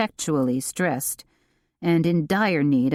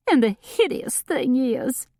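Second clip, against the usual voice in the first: much higher, tearful voice lightly played; a touch of sniffling self-pity; high, weepy wail, only lightly done; mild sniffly voice; 9 semitones higher than usual. A narrator's reading. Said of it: "sniffling, like crazy now".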